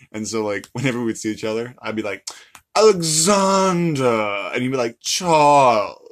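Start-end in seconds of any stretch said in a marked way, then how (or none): 2.7-4.5 put-on loud, higher, long-drawn name in an English accent; hammed-up English greeting, stretched out; mock English accent. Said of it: "these really weird accents with it". 5.0-6.0 put-on loud, drawn-out name in an English accent; hammed-up English reply, swooping; mock English accent. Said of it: "it'd be like, we're both English".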